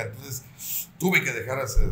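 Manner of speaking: ordinary delivery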